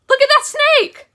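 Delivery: high, loud mom shriek; freaking out. Retold or imitated. Mom spotting the snakes on stage, freaking out. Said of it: "she was freaking out".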